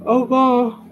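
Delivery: said through a yawn. Real, not acted. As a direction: yawn the words out. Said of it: "I'm yawning and I'm sorry".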